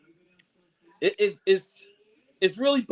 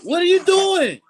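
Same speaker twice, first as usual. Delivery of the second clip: higher and louder; exasperated outburst; 9 semitones higher, 6 dB louder than usual. Real, not acted. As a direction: fling the question at the team. Rant at the Niners' roster cuts.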